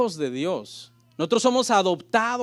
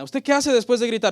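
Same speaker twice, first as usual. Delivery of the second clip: normal talking voice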